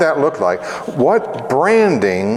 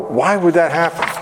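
usual speaking voice

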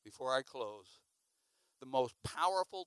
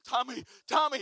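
raised, urgent call; panicked calling; 7 semitones higher, 4 dB louder than usual. Retold or imitated. Boy chased by a dog calls his friend, raised panicked voice.

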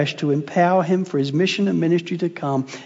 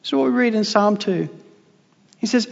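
usual speaking voice

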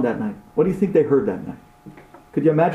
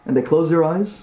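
ordinary talk

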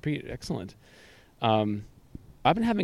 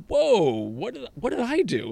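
surprised; 11 semitones higher, slower than usual. Retold or imitated. Retold puzzled reaction.

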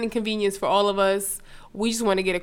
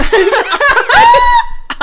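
loud high laugh; 12 dB louder than usual. Real, not acted. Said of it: "Does Lyft show the ratings".

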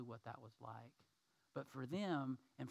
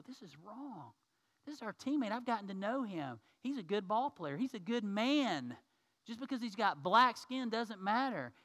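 raised, higher, earnest protest; indignant defense; 7 semitones higher, 6 dB louder than usual. Acted. Teammates defending Jackie against racism, raised and indignant. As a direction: stand up for him, loud and firm.

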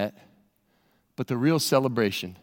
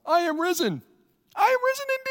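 way higher, gleeful Easter shout; joyful mock cheer; faster than usual. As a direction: crow it out like a thrilled friend. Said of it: "they were like".

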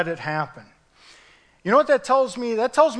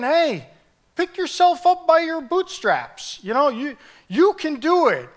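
higher, brisk bootstrap cheer; mock pep talk; 8 semitones higher than usual. Pastor mocking a self-help pep talk, higher and brisk. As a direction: fake motivational push, pitched up.

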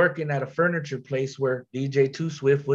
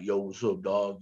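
low gangster voice; 6 semitones lower, 6 dB quieter than usual. Retold or imitated. Rapper imitating the producer's low gangster voice. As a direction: drop low and quiet like a gangster. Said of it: "a real low gangster voice".

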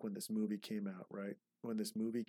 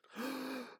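sharp gasp. Acted. Sudden gasp before big idea.

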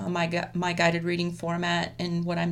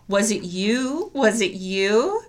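slow, louder, rising into a big question; playful poem reading; 2 semitones higher, 4 dB louder, slower than usual. Shown as a demonstration. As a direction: stretch it out and lift hard on "you". Funny ways of saying a poem line.

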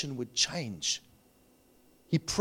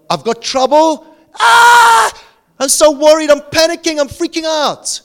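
high, loud mock panic; panicked worrier; 13 semitones higher, 13 dB louder than usual. Preacher voicing a panicking worrier, hammed-up panic. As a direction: act out someone freaking out in panic.

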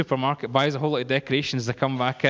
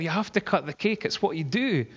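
higher-pitched, coaxing insistence; retold insistence; 5 semitones higher than usual. Retold insisting against her refusal.

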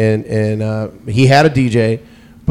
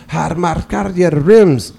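slow imitated Armenian accent; imitating a gas station stranger's Armenian accent; 6 semitones higher, slower than usual. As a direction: drawl it out in a heavy Armenian accent. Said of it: "he immediately went into an urban accent".

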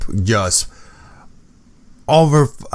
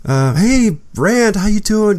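high, sing-song imitation of a breezy hello; mock girly greeting; 10 semitones higher, slower than usual. Imitating Alisa breezing past him, high and mock-bright. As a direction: mimic her airy, dismissive hello. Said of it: "and she go".

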